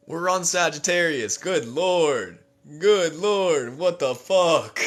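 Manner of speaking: loud, high exasperated exclamations; fiery outburst. Real, not acted. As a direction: let the fire out, loud and high. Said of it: "that was some Sagittarius fire right there".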